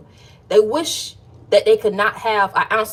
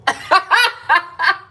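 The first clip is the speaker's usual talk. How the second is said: high giggle; 9 semitones higher, 4 dB louder than usual. Real, not acted. Laughing at herself.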